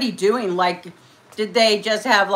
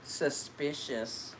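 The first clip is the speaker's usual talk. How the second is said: mock suspicious; 7 semitones lower, 16 dB quieter, much slower than usual. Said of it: "when I'm mad, I'll say".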